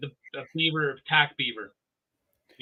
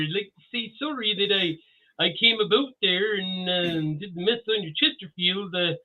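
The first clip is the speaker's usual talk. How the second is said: Canadian impression; 3 semitones higher than usual. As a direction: do a polite, chatty caricature of a Canadian. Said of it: "hear him do a Canadian impression".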